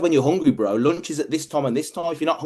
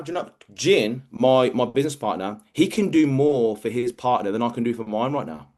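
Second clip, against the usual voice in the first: ordinary talk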